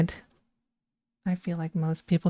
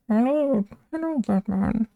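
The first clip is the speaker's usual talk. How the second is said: Bane voice; 4 semitones higher than usual. Retold or imitated. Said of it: "I can't even do the freaking voice".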